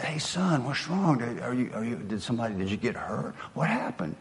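a soft, hushed worried father; gentle father. Retold or imitated. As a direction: coax the boy quietly. Father comforting crying son.

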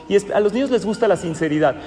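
usual speaking voice

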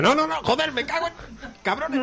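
hammed-up frustrated high rant; mock angry Candela. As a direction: imitate her cursing at being transferred. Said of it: "lo que ha molado cuando pasaba de".

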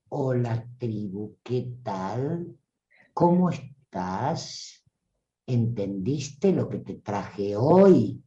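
a sleepy, deliberately paused delivery; very slow speaker. Retold or imitated. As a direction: speak so slowly the listener nods off. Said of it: "él o la que habla muy pausado".

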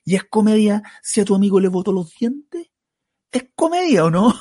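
high-pitched mock challenge; retold threat. Heckler's threat acted out. (amenaza imitada en voz aguda)

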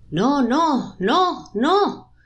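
high, urgent cries of no; inner voices screaming. A narrator's reading. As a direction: cry out in protest, pitch leaping up. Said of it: "Voces interiores que gritan". (gritos agudos de voces atrapadas)

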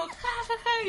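high "ja, ja, ja" laughter; his laugh. Retold or imitated. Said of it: "y él como".

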